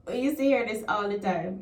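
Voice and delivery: usual speaking voice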